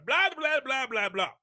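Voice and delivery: lightly mimicked infant babbling; loose, run-together mock baby talk; imitated baby babble. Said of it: "every word just seems to kinda run together".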